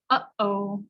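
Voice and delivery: "Uh-oh" with a catch in the throat between the vowels; "Uh-oh" cut by a throat stop; glottal uh-oh. Shown as a demonstration. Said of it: "the sound you make when you say".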